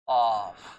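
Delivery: "of" said with an f sound; of ending in a hissed f; wrong pronunciation demo. Shown as a demonstration. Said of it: "But wrong".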